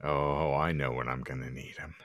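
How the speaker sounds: ordinary talk, nothing marked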